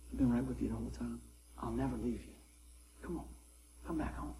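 hushed, low voice of a loving God; tender murmured invitation home; loving reassurance. Retold or imitated. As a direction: reassure very softly, almost whispering. Voicing a loving God, very soft.

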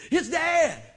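high, loud, thrilled cry; joyful high cry of recognition; excited shout. Pretend. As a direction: shout it like the call you waited for. Grabbing Bible with excitement.

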